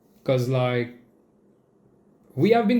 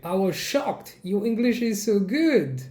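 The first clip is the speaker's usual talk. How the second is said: higher-pitched gushing commenter; mock surprise; 6 semitones higher than usual. Quoting a commenter shocked at the good English, mock surprise. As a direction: imitate an amazed compliment, pitched up.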